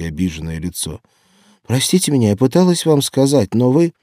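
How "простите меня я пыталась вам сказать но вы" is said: higher, louder, pleading explanation; flustered apology. A narrator's reading. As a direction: apologize urgently, breaking off at the end. Маша торопливо оправдывается перед капитаном. (взволнованное оправдание)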